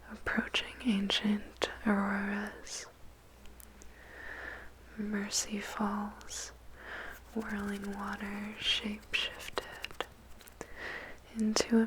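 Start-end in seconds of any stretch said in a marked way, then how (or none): none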